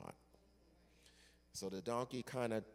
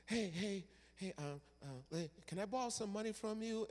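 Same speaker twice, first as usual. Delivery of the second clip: high, quick, wheedling asks; sweet-talking for a loan; moocher; 8 semitones higher than usual. Acted. A moocher asks the congregant for money, wheedling.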